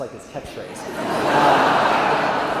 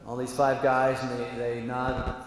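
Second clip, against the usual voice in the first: ordinary talk, nothing marked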